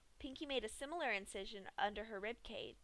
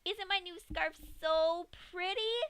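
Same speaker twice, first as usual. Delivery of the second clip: high, slow, posh-pretending impression; Pinky hams up Rarity; mock Rarity voice; 9 semitones higher, 5 dB louder, slower than usual. A narrator's reading. Pinky mocks Rarity while gutting Dash, hammed-up. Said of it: "Pinky laughed".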